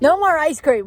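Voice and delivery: high, loud scolding of herself; self-scolding. Real, not acted. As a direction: snap at yourself, half joking. Scolding herself over ice cream.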